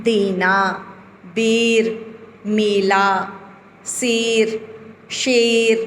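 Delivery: बेर and शेर with a clear, held ए; teacher voicing the ए words; clear ए vowel. Shown as a demonstration. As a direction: spell out the ए in मेला, word by word. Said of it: "ए लग रहा है".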